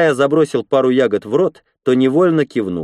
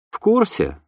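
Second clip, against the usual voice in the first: unenthusiastic; 11 semitones higher than usual. A narrator's reading. Katya confirms she knows the procedure, unenthusiastic. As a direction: confirm it with no delight, pitched up. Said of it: "без восторга ответила Катя".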